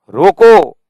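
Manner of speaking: loud, high रोको, then a break; comma after रोको. Shown as a demonstration. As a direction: punch रोको as a standalone command. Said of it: "comma".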